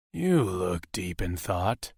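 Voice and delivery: low, slow and husky, close to the ear; a lover's husky hello. Acted. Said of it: "a husky voice spoke near his ear".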